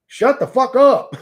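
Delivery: yelling at a heckler in chat, a loud shout; sudden yell. Real, not acted.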